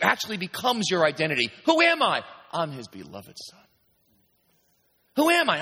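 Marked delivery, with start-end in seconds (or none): 1.7-2.2 high, loud questioning call; preacher asking the crowd; rhetorical question. Shown as a demonstration. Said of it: "rhetorical question and answer". 2.5-3.6 softer, settled reply; preacher answering his own question; quiet answer. Shown as a demonstration. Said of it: "answer to own question". 5.1-5.6 high, loud questioning call; preacher asking the crowd; rhetorical question. Shown as a demonstration. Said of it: "rhetorical question repeated".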